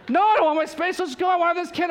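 high and loud, insistent; wife in labor ordering her husband to go, impatient. Retold or imitated. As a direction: demand it, no patience left. Wife insists on going now.

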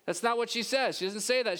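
ordinary delivery

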